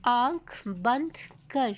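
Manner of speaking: slow, deliberate, word by word; slow clear reading. Shown as a demonstration. Teacher reading an example to students, slow and clear. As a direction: read it out carefully for learners. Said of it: "जो हम आपको पढ़ के सुनाएंगे".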